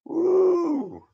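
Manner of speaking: high loud celebratory whoop; excited whoop. Real, not acted. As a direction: let out a happy whoop. Hoots at viewer's new truck.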